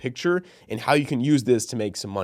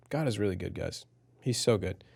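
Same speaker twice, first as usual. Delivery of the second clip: softer and lower, choked up; quietly moved, holding back tears; real tearful gratitude; emotional; 4 semitones lower, 7 dB quieter than usual. Real, not acted. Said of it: "why the frick am I getting emotional".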